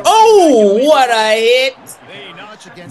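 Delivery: high, slow drawn-out amazed cry. Real, not acted. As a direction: savour the big hit. Big hit on the field.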